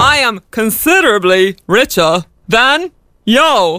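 slow, drawn-out boastful character voice; comedy catchphrase impression. Host reciting a nineties sketch to its creator, boastful character voice. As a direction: recite the catchphrase deliberately, word by word. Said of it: "That was very good".

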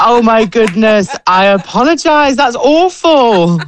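high-pitched, drawn-out dismay; mortified apology. Real, not acted. Guest learns he missed the host's message, mortified. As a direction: wail it apologetically, stretching the vowels.

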